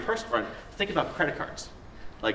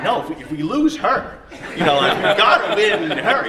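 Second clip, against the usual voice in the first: higher, louder, laughing insistence; eager protest; 8 semitones higher, 10 dB louder than usual. Retold or imitated. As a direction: push hard to win her over, laughing. Retold eager protest to wife.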